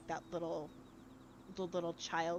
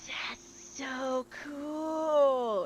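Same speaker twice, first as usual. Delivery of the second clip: slow, loud, higher that's so cool; slow amazed delight; 7 semitones higher, 10 dB louder, much slower than usual. Acted. Player marvels at the boy's magic, slowly amazed. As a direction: gush in wonder for the kid.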